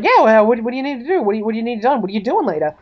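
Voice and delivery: over-keen, tumbling questions; excited. Acted. Detective offers help to his crush, excited. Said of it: "he gets really excited".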